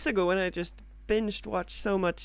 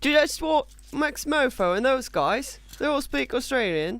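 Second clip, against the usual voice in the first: put-on Australian, slightly raised; Australian accent; 3 semitones higher, 4 dB louder than usual. Shown as a demonstration. Co-host demonstrating for the host, put-on Australian accent. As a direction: show off a mock Australian accent. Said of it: "Can you do an Australian accent".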